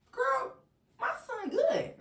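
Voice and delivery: loud, very high squawk; indignant granny imitation. Granny indignant in retold phone call.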